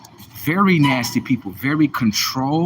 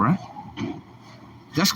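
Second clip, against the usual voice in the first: ordinary talk, nothing marked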